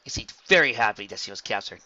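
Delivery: ordinary delivery, nothing marked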